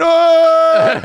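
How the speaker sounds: loud, high jeering shout; kids' jeering yell. Retold or imitated. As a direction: jeer like a rowdy kid in the stands. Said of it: "the kids are going right back at them, going".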